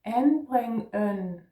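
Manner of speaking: "En bring en" said slowly with the D silent; string En bring en together slowly, no D sound; slow syllables. Shown as a demonstration. Teacher slowly joining the first three syllables. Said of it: "which is pronounced only en".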